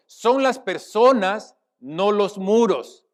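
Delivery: loud, high and very slow proclamation; solemn declamation. Acted. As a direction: declaim each phrase weightily, pausing between. (declamación solemne y pausada)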